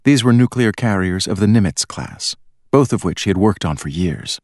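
ordinary delivery, nothing marked

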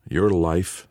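usual speaking voice

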